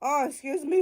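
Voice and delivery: burp slipping into the words. Real, not acted. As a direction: talk right through a burp. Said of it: "that was a fire burp".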